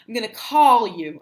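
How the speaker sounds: ordinary talk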